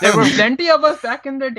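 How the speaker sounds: put-on high Indian-accented pirate. Indian pirate boasts of his kind, Indian accent. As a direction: lift the pitch into an Indian accent. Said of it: "this be Indian pirate".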